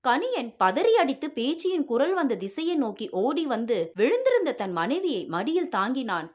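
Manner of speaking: ordinary delivery, nothing marked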